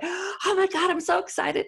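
high, loud gushing squeal; shrieking with delight at the door; overexcited squeal. Retold or imitated. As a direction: gush at her like a thrilled fan. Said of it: "I scared the hell out of her".

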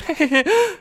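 high giggle, then audible breath in; laugh with inhale. Shown as a demonstration. Showing a friend's cute habit, laugh with inhale. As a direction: demonstrate a cute intake after laughing. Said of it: "so dieses Einatmen".

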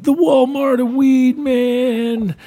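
high drawn-out dude voice; mock stoner, high and slow. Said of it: "They're like".

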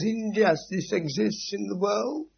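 high, slow, whiny wail of hurt; mock crying complaint. Struck hand crying to its owner, a hammed-up whine. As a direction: whimper it like a wronged child. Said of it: "starts crying and says".

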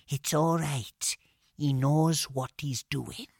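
calm, slow and hushed; quiet. A narrator's reading. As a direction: say it softly and slowly. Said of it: "said very quietly".

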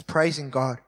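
ordinary delivery

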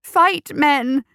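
high, loud rallying cry; shrieked battle call; cried out. A narrator's reading. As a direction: yell to spur on the fighters. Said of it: "cried McMorning".

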